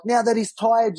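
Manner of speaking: high sarcastic protest; indignant. Retold or imitated. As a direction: scoff it, pitched right up. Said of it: "I'm like".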